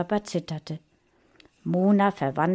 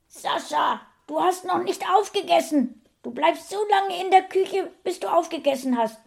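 high, scolding call from afar; mother calling out; 12 semitones higher than usual. A narrator's reading. Mother calling from the living room, scolding. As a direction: order him in a high, strained voice. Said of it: "rief Saschas Mutter aus dem Wohnzimmer".

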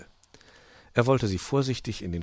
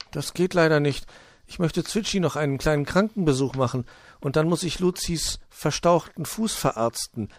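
higher, measured character voice; Puh, declining; 8 semitones higher, 4 dB louder than usual. A narrator's reading. Puh excusing himself to visit patients, high and polite. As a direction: politely turn him down, voice pitched up.

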